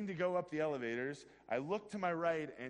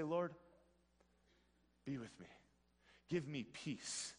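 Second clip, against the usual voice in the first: quiet prayer; 3 dB quieter than usual. Retold or imitated. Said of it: "And you just say".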